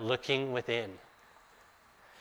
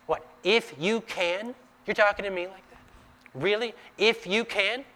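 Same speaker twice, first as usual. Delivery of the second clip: higher, mocking disbelief with rising questions; incredulous challenge; 7 semitones higher than usual. Jesus challenging the doubting father, incredulous. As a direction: push back, amazed he'd say that. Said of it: "he just takes the exact same phrase".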